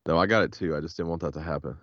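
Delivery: ordinary talk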